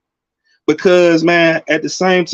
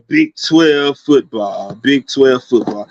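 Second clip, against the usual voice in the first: drawn-out announcement; 5 semitones lower, slower than usual. Acted. Announcing a new segment.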